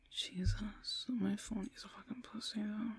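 soft, half-whispered aside; muttered annoyance. Acted. Alone after arguing with her boyfriend, muttered annoyance. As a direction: grumble privately to yourself. Grumbling to herself.

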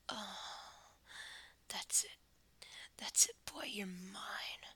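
ordinary talk

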